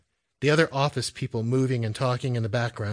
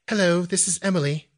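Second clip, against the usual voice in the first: higher-pitched British phone greeting; British accent; 8 semitones higher than usual. A narrator's reading. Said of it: "she said with her British accent".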